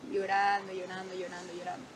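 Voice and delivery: low, trailing-off repeats; subdued repetition. Real, not acted. Stressing how long she cried.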